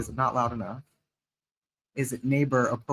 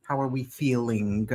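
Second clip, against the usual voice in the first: ordinary delivery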